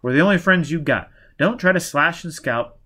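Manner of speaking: irritated, forceful dressing-down; angry. A narrator's reading. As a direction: tell them off heatedly. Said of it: "Quat said angrily".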